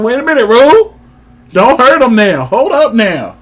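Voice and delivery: high loud cheer; excited outburst. Retold or imitated. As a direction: holler up high in delight. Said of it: "I'm like".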